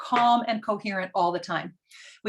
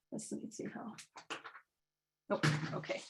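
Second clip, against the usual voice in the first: whispering to herself while checking something; whispered aside; 14 dB quieter, faster than usual. Real, not acted. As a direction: drop to a whisper, just for yourself. Speaker fumbling aside off-mic.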